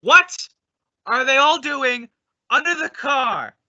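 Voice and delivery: high, loud "What", still high after; baffled outcry. Acted. Abbott shrieking at everyone under the car. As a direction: squawk in disbelief.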